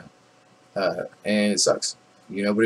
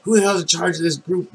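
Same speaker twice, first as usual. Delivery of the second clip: mock yell; 8 semitones higher than usual. Sergeant major demands who leads the gaggle, mock yell. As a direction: retell a superior's yell in a raised voice. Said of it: "He starts yelling".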